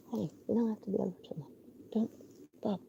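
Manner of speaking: fast, hushed whisper; whisper to the dog. Real, not acted. Owner soothing her dog at night, whispering. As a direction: whisper quickly so as not to wake anyone.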